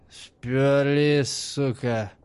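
put-on aggrieved drunk drone; hurt drone. A narrator's reading. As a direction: drone it, offended. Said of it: "гудел обиженно Станис".

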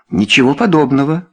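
objecting. A narrator's reading. Wife contradicting her husband, objecting. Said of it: "возразила его жена".

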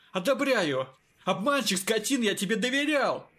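angry scolding outburst. A narrator's reading. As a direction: scold him angrily. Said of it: "бранил он".

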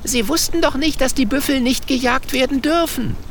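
put-on high, urgent young girl protesting; a young girl protesting her case, voice up high. A narrator's reading. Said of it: "ruft Wilde Hummel".

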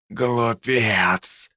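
wheezed out in a rasp; hoarse rasp. Acted. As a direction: rasp it through the chest. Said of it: "прохрипел бледный". (хриплым голосом)

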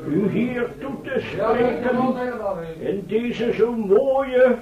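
formal speech. Acted. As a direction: orate to the crowd with ceremony. Professor addresses the hall. (plechtige toespraak tot de zaal)

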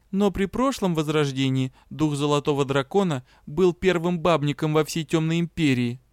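plain reading voice

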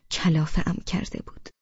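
usual speaking voice

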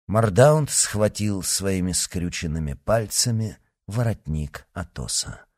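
ordinary delivery, nothing marked

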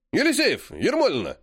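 sharp, high, offended shout of names; offended bark. A narrator's reading. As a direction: bark the names like an insulted gym coach. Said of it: "оскорблённо рявкнул физрук".

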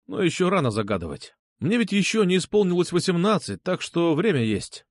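ordinary delivery

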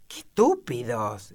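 slow, indignant exclamation, only mildly loud; understated outburst from Alicia. A narrator's reading. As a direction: exclaim it aloud, drawn out. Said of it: "exclamó Alicia con voz alta".

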